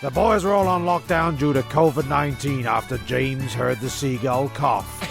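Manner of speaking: ordinary talk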